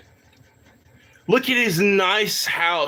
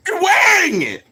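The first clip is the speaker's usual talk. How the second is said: higher and louder; rising shout; 10 semitones higher, 4 dB louder than usual. Real, not acted. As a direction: push it up in disbelief. Repeats building.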